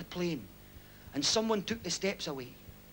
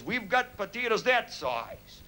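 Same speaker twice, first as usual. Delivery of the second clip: put-on slow, drawn-out American accent; big American accent; 2 semitones higher, much slower than usual. Big American boasting about potato size, slow American accent. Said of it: "what about the big American".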